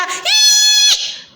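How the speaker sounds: very high, loud imitated diva shriek; imitated singer's shriek. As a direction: mimic a pop star's scream. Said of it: "Surtout quand elle crie là". (cri aigu imité d'une chanteuse)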